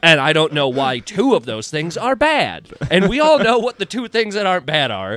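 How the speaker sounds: comic venting at a bigoted heckler; indignant rant. Real, not acted. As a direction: build to a baffled outburst. Ranting at the heckler's insults.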